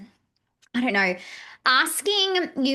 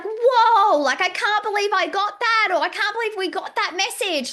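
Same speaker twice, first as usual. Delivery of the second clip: high, louder burst of awe; acted amazement; 9 semitones higher, 4 dB louder than usual. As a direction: relive your astonished delight, pitched well up. Acts out daily amazement at messages.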